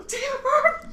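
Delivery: high, delighted damn it; thrilled exclamation. Retold or imitated. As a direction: squeal it with joy. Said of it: "I was like".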